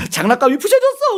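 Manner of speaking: high-pitched wailing child imitation; mock crying. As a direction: wail it like a child over a broken toy. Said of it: "아이들은 막 울잖아요".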